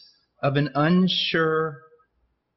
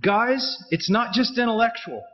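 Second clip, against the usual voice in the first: raised, urgent preaching voice; fervent preaching; 3 semitones higher than usual. Real, not acted. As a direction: press the point home, pitch up. Preaching at full force, raised pitch.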